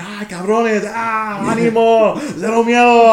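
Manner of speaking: high, loud Spanish cheering; cheering scream. Retold or imitated. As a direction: yell encouragement at the wrestlers. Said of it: "I was freaking screaming the whole time".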